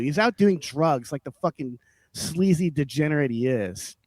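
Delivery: normal talking voice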